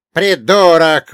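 high, very loud, drawn-out jeer; dwarf's yelled insult. Acted. Dwarf from under a captor's arm, yelled insult. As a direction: yell the insult out long. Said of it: "выкрикнул карлик".

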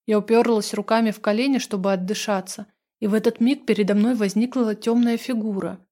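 usual speaking voice

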